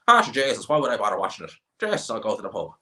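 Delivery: lightly put-on Irish country voice; a hint of a Midlands local; mocking rural Irish accent. Irish local shrugging off a fight card, light country accent. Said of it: "what the Midlands of Ireland is like".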